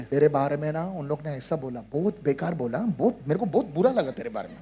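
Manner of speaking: low, soft, rapid tattling; confiding gossip. Acted. Someone bringing hurtful gossip to a believer, low confiding voice. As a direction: murmur it quickly as if passing on secrets. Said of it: "Somebody comes and tells you".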